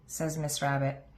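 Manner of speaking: usual speaking voice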